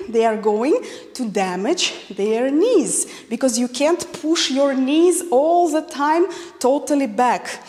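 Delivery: ordinary talk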